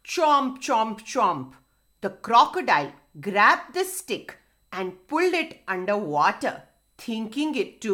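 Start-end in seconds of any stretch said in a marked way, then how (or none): 0.0-1.5 punchy storybook chomps; chomping. Acted. Crocodile grabbing at the stick, punchy chomping. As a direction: act out the snapping bites as words. Crocodile bites, sound word acted.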